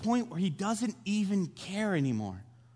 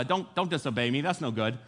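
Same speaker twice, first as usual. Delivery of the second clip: offhand scolding; 6 semitones lower than usual. Retold or imitated. God's offhand warning after lightning.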